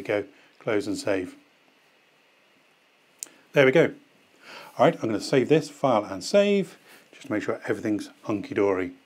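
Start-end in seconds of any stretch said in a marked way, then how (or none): none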